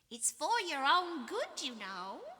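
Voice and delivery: high, taunting, sing-song poltergeist voice; high mischievous sneer, drawn out; mocking poltergeist. A narrator's reading. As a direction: tease them like a gleeful prankster spirit. Peeves voiced by narrator.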